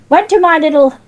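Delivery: ordinary talk, nothing marked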